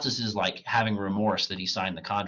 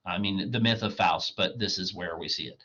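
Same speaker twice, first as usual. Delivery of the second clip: ordinary delivery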